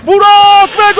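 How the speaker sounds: sudden loud goal shout; screaming football commentator. Real, not acted. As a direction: burst out with the goal. Goal call in live commentary.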